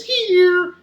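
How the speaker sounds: high, loud, drawn-out call. A narrator's reading. Invisible child answering mummy, calling out. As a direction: sing out slowly from hiding. Child calls back to mother.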